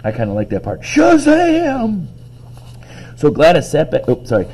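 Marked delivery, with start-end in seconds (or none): 0.8-2.1 very high, drawn-out gleeful cry; exclaimed. Acted. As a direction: relish it as a comic-book catchword. Reader repeats favourite yelled word.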